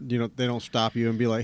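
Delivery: normal talking voice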